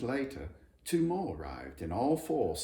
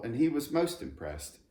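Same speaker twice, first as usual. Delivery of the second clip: plain reading voice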